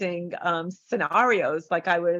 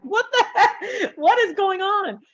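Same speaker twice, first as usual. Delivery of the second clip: laughing disbelief; 12 semitones higher, 4 dB louder than usual. Real, not acted. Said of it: "baffled at her own spiral".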